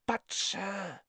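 high, soft elf voice; hesitant high little elf; house-elf voice. Acted. Dobby the house-elf speaks.

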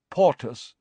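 normal talking voice

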